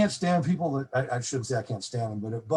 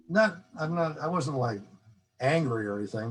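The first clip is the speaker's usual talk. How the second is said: ordinary talk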